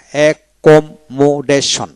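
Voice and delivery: slow, split "accommodation" into e-kom-mo-deshon; syllable by syllable. Shown as a demonstration. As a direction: spell out "accommodation" in separate beats for a learner. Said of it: "এইভাবে".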